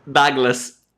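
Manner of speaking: DOUGLAS com "a" aberto, como "Dáglas"; pronúncia local de Douglas. Shown as a demonstration. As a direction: diga Douglas abrindo a primeira vogal em "á". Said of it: "chamavam o pastor de Douglas". (Douglas pronunciado "Dáglas", à moda local)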